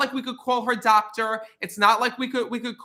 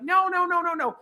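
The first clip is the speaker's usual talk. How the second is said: whiny refusal; 7 semitones higher than usual. Retold or imitated. Sick wife refusing to get up.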